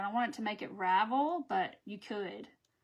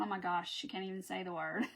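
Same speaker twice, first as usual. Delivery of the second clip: mock exasperation; 4 semitones lower, 7 dB quieter than usual. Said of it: "rolling their eyes going".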